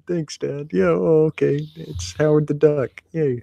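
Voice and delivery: high, mock-grateful kid letting the disappointment show; a kid faking delight over a dud comic; sarcastic kid voice, high and deflated; sarcastic thanks. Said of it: "if your dad brought back comics".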